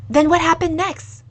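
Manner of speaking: high, loud and eager. Acted. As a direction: rush the question out, hungry for the rest. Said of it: "asked quickly".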